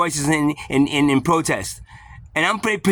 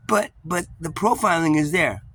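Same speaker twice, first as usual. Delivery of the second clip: ordinary delivery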